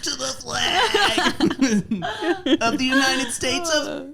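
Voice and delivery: high voice breaking into laughter; laughing through pledge. Retold or imitated. Narrator miming the crotch pledge, high and laughing. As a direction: try to recite while cracking up.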